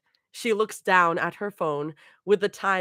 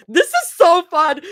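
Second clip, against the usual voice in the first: real laughter, much higher and louder; cracking up mid-line; laughing; 12 semitones higher, 10 dB louder than usual. Real, not acted. Narrator breaks into laughter.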